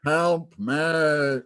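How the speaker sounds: loud, high mock American "help me"; shouted fake American accent from a German villain; Alan Rickman's bad American accent. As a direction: overplay a poor American accent as a plea. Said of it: "Alan Rickman's horrible American accent".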